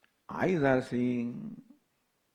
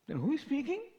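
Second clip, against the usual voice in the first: pitched up, questioning the wise man; high challenging question; 12 semitones higher, 4 dB quieter, faster than usual. Retold or imitated. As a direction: ask it high and puzzled, a touch incredulous. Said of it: "What do you mean you do not speak?".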